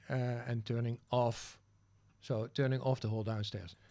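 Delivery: usual speaking voice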